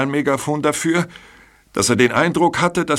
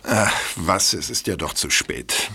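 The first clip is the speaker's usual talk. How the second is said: resigned; 6 semitones lower, 4 dB quieter than usual. Acted. Culprit gives up.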